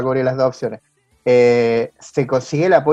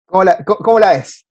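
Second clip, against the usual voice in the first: higher, louder mock catchphrase; raised-voice imitation of a singer's catchphrase; 5 semitones higher, 7 dB louder than usual. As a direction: toss it out as a loud, playful impression. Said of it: "como dice [name]".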